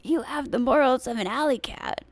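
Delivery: imitating Biden as a very old man; super old man voice. Host mimicking a favorite debate jab for laughs, super old man voice. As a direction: put on a comic old-man voice. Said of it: "like, super old, old man voice".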